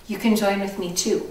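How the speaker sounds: normal talking voice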